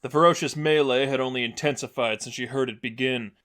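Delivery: plain reading voice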